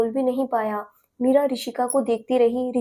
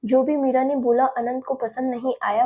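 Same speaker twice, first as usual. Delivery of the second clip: plain reading voice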